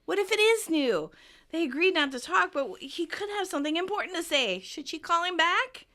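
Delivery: imitating a woman in a tizzy; frantic. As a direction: fret anxiously, question after question. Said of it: "But Monica's frantic".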